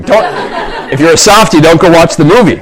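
laughing through the warning. Real, not acted. Pastor laughing to the congregation about the sad movie, laughing. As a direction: laugh it out, half teasing.

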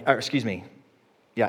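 ordinary talk

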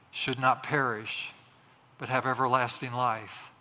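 low, measured praying voice; prayer. Real, not acted. Pastor prays to the Lord for listeners, reverent. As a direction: address God reverently, phrase by phrase with pauses.

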